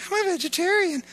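high, mocking voice of a picky eater; mock vegetarian. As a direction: toss it off in a silly high voice.

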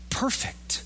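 a hushed, reverent whisper of "perfect,". Acted. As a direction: breathe "perfect," as an awed whisper. Whispered attribute of God.

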